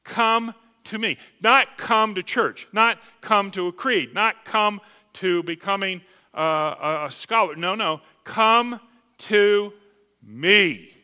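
impassioned sermon delivery; fervent preacher calling the flock; preaching. Acted. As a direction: preach it with full conviction. Preaching the invitation.

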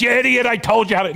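high, fast mock scolding; scolding GPS voice. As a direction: imitate an exasperated satnav lady. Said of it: "and the female voice says".

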